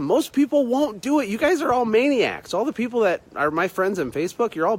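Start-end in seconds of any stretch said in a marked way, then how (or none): none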